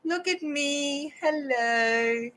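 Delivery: high, slow cat voice showing off; a preening cat saying hello to the world; voicing the cat. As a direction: owner speaking for her cat, high and slow. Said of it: "You say".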